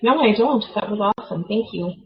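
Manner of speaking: ordinary delivery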